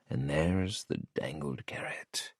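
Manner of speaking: slow unvoiced whisper. A narrator's reading. Santi notes the bribe privately, slow whisper. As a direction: hush it as a wry aside.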